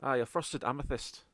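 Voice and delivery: AMETHYST said assuredly in Scottish, falling at the end; Scottish accent. Acted. Contact confirming the gem to party, settled Scottish accent. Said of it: "say the word properly in a Scottish accent".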